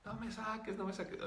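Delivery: high child's voice, imitating fear; frightened little girl. Scared spirit answers the healer, imitated little-girl plea. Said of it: "Tenía mucho miedo".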